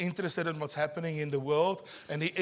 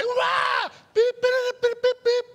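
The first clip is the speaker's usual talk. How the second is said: much higher, louder cartoon yelp then beeping; mock electric shock scream; 6 dB louder, slower than usual. Preacher acting out the shocked Australian for the congregation, squealing and beeping. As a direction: squeal like a man getting zapped, then beep like the fence. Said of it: "I say".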